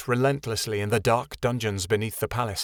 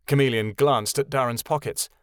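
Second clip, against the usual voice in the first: ordinary delivery, nothing marked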